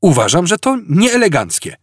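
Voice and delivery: high, indignant protest; deeply offended, prim objection. A narrator's reading. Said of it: "był do głębi oburzony".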